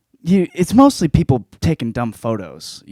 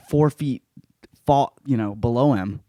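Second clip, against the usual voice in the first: ordinary talk, nothing marked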